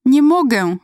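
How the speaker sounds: high, loud tearful cry; choked through tears. A narrator's reading. Stuck girl answers her mother, crying. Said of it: "wykrztusiłam przez łzy".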